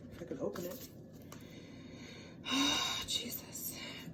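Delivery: exasperated whisper. Real, not acted. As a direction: breathe it out under breath. Struggling to open lid.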